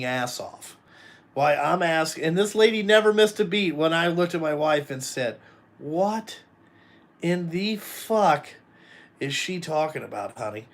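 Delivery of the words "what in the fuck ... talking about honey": slow, spaced-out disbelief; retold bewilderment. Groom asking his wife about the officiant's reading, retold bewilderment. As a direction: stretch each word in mock bafflement.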